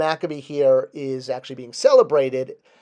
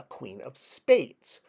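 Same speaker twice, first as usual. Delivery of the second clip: usual speaking voice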